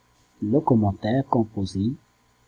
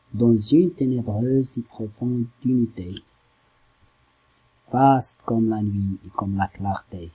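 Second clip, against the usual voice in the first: poem recitation. Acted. Teacher gives students a first reading of Baudelaire's poem, measured recitation. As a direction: read the poem out line by line, with deliberate pauses.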